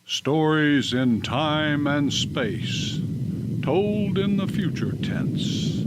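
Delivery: slow, measured radio-show opening; solemn old-time radio intro; dramatic announcer. Acted. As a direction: announce it like a vintage sci-fi broadcast.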